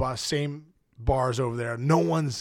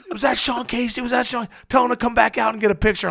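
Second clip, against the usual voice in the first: excited fans; 9 semitones higher than usual. Retold or imitated. Fans shouting at bus.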